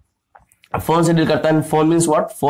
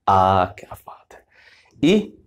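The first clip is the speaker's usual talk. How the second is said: whispered recital; 8 semitones lower, much slower than usual. Shown as a demonstration. Teacher leading students through the Hindi vowels, whispering. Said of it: "जरा मेरे साथ बोलिएगा".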